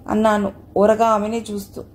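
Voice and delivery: ordinary delivery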